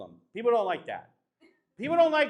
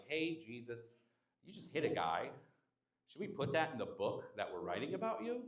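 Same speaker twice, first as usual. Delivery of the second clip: low, much softer timid voice; hushed disciple; 6 semitones lower, 12 dB quieter than usual. Retold or imitated. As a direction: ask Jesus nervously under your breath. Disciples question Jesus, quoted.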